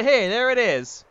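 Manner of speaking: mock casual callout. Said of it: "just come over here and say".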